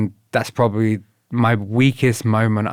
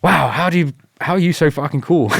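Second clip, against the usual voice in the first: mock awe; 7 semitones higher, 5 dB louder than usual. Retold awe at instructor.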